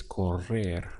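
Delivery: correr said slowly with a rolled double R; Spanish correr demo. Shown as a demonstration. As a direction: roll the R in correr clearly and slowly. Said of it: "like that".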